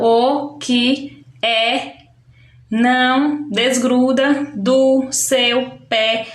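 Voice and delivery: "o que é não desgruda do seu pé" slowly, a pause after each word; slow, spaced-out demonstration of "o que é não desgruda do seu pé". Shown as a demonstration. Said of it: "são palavras que têm uma sílaba".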